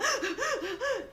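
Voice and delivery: high, nervous panicked squeal; mock panic yelp. A swimmer squealing as water reaches her face, panicked. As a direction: squeal like you're scared of the water. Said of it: "I go".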